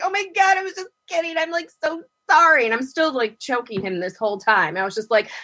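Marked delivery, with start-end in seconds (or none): 0.0-2.6 flustered apology. Retold or imitated. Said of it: "He's just like".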